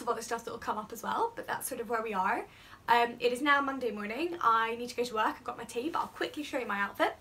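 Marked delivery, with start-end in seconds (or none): none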